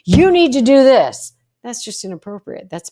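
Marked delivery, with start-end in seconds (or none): 0.1-1.3 loud, high, barked demand; bully them with a sharp order; aggressive. Shown as a demonstration. Coach shows bullying tone to family, loud aggressive. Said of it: "I'm not saying aggressive".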